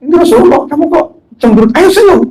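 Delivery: high-pitched acted angel chiding a colleague; high, animated telling-off; voice of Jibril. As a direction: urge them to cheer up in a high, lively voice. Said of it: "Ditegur sama malaikat Jibril".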